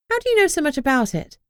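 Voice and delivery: high, quick and prying; curious prying question; nosy. A narrator's reading. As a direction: poke for gossip eagerly. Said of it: "I asked nosily".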